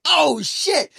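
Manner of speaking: very high, mock shocked exclamation; imitated coach's shock. Coach seeing the fighter drop, high mock shock. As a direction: let it leap up high like a startled coach.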